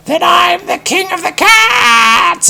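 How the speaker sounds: very high, loud, slow cry of the king of the cats; triumphant cat shout. Acted. Tom the cat claims the crown, shrieking high and loud. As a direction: crow it to the rafters like a cat claiming a throne. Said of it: "and then Tom spoke".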